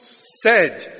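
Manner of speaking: ordinary talk, nothing marked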